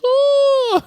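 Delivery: very high drawn-out "oh"; high-pitched mock animal call; creature hoot. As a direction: imitate an excited Bigfoot cry. Imitates Harry seeing George on TV.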